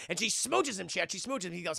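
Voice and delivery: plain reading voice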